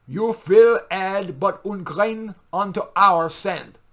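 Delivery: poetry reading. Acted. Poet performing his poem for viewers, booming declamation. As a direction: build the refrain into a booming, high chant. Reads poem McCarthyism from the Left.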